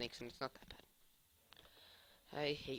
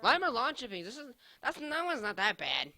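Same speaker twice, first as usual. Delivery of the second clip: excited; 7 semitones higher, 9 dB louder than usual. Real, not acted. Tasting a bean, high and loud.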